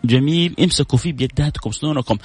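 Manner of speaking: usual speaking voice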